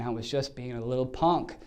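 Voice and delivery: ordinary delivery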